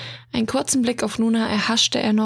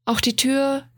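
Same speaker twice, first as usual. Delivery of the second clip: plain reading voice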